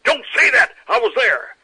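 loud, high outburst cutting down a denial; indignant shout. Retold or imitated. Veteran rebuking a boy who doubts his story, shouting.

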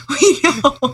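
laughing while saying it. Real, not acted. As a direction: giggle through the words. Laughing through repeated line.

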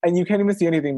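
ordinary talk

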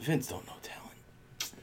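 whisper. Real, not acted. Conspiratorial aside.

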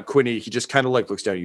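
ordinary delivery

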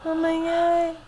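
drawn-out mock cry; mock wail. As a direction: wail it out slowly. Exaggerated reaction to echo.

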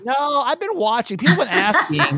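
high laughing reply, words through giggles. Real, not acted. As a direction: laugh while answering the joke. Laughing at neighbor joke.

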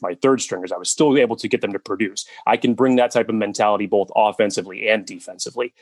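ordinary talk

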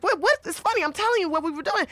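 bewildered protest. Retold or imitated. Confused by his accusation.

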